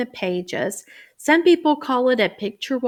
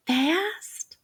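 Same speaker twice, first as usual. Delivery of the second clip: whispered word; 8 semitones higher, 5 dB quieter than usual. Shown as a demonstration. Teacher to kids, whispered word. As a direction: whisper fast, rising. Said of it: "What letter would you expect".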